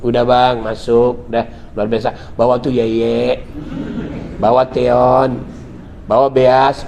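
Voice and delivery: tender welcome. Retold or imitated. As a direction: invite him in gently, teasing his lisp slowly. Wife welcoming husband softly.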